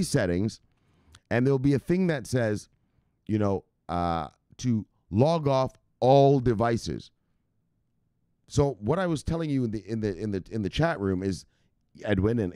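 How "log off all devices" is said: "log off all devices" very slow and louder; half-speed, forceful "log off all devices"; emphatic instruction. Shown as a demonstration. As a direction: draw out "log off" and "all", punching them. Instructs which option to pick.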